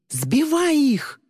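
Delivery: high, long-drawn shouted command; yelling. A narrator's reading. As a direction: scream the order, stretching it out. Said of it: "завопил Тристин". (отчаянный вопль)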